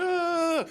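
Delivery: high, loud mock wail; mock crying wail. Comic imitating a twin being beaten, high mock wail. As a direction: imitate a beaten kid bawling. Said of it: "He's crying".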